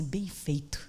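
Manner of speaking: quiet, dry "Bem feito" with a chuckle; a mother's low, knowing "Bem feito"; mother's mock scolding. Mother teases her scared daughter with a quiet, dry "Bem feito". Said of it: "Ela olhou e falou assim".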